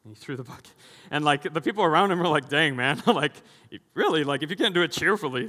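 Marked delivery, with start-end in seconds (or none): 1.1-5.5 loud, incredulous outburst; loud disbelief. Retold or imitated. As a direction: make it a loud disbelieving reaction. Excited retelling of people's reaction.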